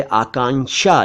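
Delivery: आकांक्षा said as "aakaan-sha"; mispronounced आकांक्षा. Shown as a demonstration. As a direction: drop the k before sh in आकांक्षा. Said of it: "लिख देते हैं और कहते हैं".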